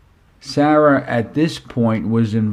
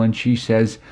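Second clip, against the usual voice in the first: usual speaking voice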